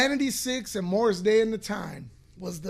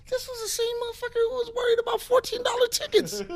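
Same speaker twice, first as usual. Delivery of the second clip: much higher, fast, disbelieving; incredulous squeak; faster than usual. Acted. Incredulous aside, laughing.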